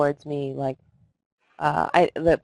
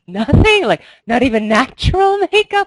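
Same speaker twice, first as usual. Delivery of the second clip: high, loud mock dismay with a laugh breaking in; retold shocked disbelief; 9 semitones higher, 6 dB louder than usual. Actresses reacting to the no-makeup order, high shocked disbelief. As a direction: reenact being aghast, pitched well up.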